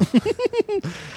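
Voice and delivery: squeaky high-pitched laugh. Real, not acted. Laughing at own story.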